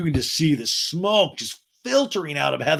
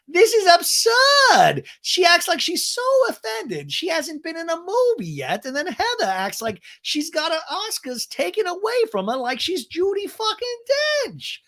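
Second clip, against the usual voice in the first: mocking high shout; 3 dB louder than usual. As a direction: mimic her outrage in a shrill-pitched shout. Co-star's talking head rant.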